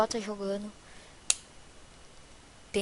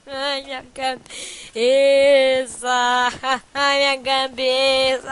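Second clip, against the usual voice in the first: slow drawn-out moan; 2 semitones lower, slower than usual. Real, not acted. Player lamenting his block head, slow drawn-out moan. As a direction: stretch the words out, very slow. Same line repeated, drawn out.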